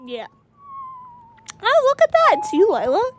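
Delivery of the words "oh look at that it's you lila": excited greeting. Real, not acted. Friend joins the game.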